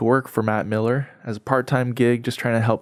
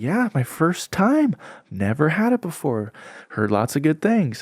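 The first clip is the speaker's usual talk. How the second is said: high-pitched imitation of a gentle newcomer; overly nice stranger; 5 semitones higher than usual. Stranger on his first visit, imitated as overly nice. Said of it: "Why is he talking like, bro, he's just so nice".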